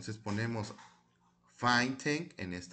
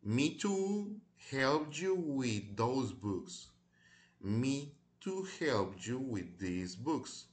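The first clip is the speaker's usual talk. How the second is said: very slow, word-by-word model reading; slow language-teacher read-aloud; pronunciation model; much slower than usual. Shown as a demonstration. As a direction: draw each word out for learners to copy. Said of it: "les voy a ayudar con la pronunciación".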